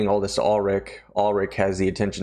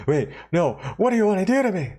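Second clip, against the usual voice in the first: high alarmed voice; 10 semitones higher, faster than usual. Retold or imitated. Ulrich alarmed by proposition.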